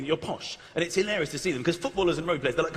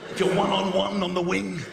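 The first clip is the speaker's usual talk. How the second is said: ordinary talk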